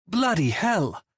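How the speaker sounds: high-pitched exasperated oath; exasperated outburst. A narrator's reading. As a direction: swear in exasperation, pitch jumping up. Manager's outburst at bad news.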